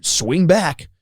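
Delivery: lightly mocked generic game announcer; a hint of a Nintendo Direct narrator. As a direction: read the rules like a bland trailer voiceover. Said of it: "the most generic voice of all time".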